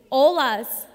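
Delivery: ordinary talk, nothing marked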